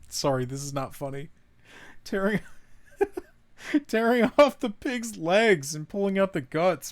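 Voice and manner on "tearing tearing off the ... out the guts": reading through real giggles, pitch up; can't keep a straight face reading the grim diary; reading a grisly diary entry aloud, breaking into laughter; laughing while reading. Real, not acted. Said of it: "I'm laughing 'cause I wasn't expecting".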